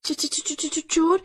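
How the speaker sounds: high, stuttering alarm; frightened stammer. Acted. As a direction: stammer on the first sound in fright. Wife scared by news of thieves.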